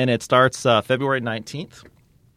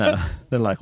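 normal talking voice

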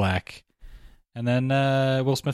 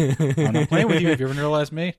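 mock insistence; 6 semitones higher, 3 dB louder than usual. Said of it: "they're yelling at each other".

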